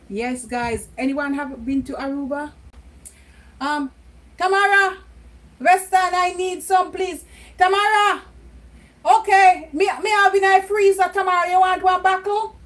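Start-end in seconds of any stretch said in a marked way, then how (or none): 4.4-12.6 calling out. Real, not acted. Calling out to Tamara in house.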